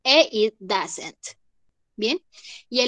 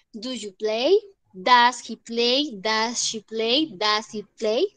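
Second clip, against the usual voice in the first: slow, careful question drill; measured classroom repetition; slower than usual. Shown as a demonstration. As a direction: say each question slowly for students to copy. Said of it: "teacher drilling question forms".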